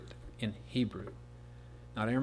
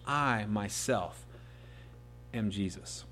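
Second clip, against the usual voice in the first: solemn declaration; 3 semitones lower, 6 dB quieter, slower than usual. Acted. Divine voice, solemn slow.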